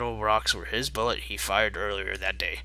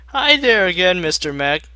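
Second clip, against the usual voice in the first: much higher, louder cheery greeting; high Rainbow Dash voice; 8 semitones higher, 8 dB louder than usual. Acted. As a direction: bounce in with a bright hello.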